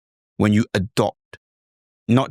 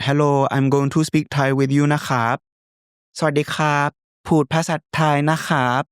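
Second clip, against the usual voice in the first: high, lilting Thai accent; Thai tones; 4 semitones higher, much faster than usual. Shown as a demonstration. Teacher showing tones in conversation, Thai accent. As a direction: rise and fall politely like Thai speech. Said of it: "their tones are completely different".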